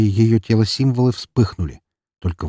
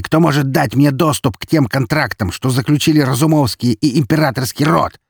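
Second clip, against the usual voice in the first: loud, raised-pitch angry rant; heated, forceful shouting; furious tirade; 7 semitones higher, 4 dB louder than usual. A narrator's reading. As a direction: rail in a rage, easing slightly toward the end. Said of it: "заорал он".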